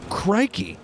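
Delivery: high, sudden yelp of surprise; surprised exclamation. Real, not acted. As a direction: blurt it out in shock.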